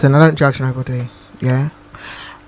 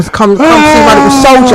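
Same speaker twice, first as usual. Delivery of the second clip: put-on cocky boy; 9 semitones higher, 4 dB louder than usual. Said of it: "mother voicing boy at door".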